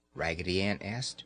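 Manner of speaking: usual speaking voice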